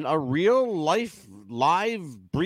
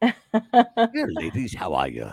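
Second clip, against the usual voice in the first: [name] impression; 9 semitones lower, slower than usual. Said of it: "Sound more like [name]".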